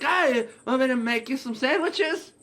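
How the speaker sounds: loud, high-pitched mock granny voice; startled-awake granny. Granny waking up real quick, loud eager offer.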